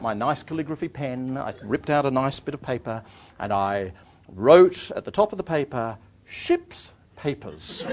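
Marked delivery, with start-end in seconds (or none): 6.3-7.9 very slow, drawn-out title; written out slowly. Acted. Traveller writing a bogus title on paper, slow and deliberate. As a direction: spell it out grandly, word by word.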